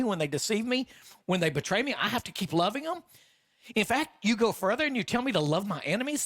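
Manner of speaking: high, disbelieving questions piling up; incredulous protest. Acted. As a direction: argue back at Jesus in disbelief. Acted incredulous protest to Jesus.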